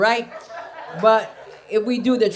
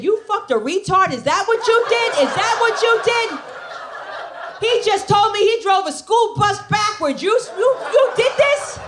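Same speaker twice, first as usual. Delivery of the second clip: high, loud mock outrage; husband's outrage; 11 semitones higher, 3 dB louder than usual. Comic imitating angry husband at the funeral, high loud outrage. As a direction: squeal it in shocked fury. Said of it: "he said".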